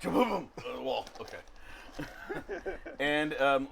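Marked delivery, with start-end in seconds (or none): 0.0-0.5 high, loud comic "boom"; mock crash boom. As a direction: sound out the crash into the wall. GM sounds the crash into wall.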